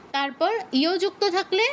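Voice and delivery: ordinary talk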